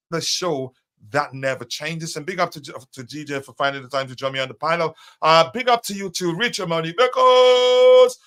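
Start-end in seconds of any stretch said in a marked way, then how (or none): none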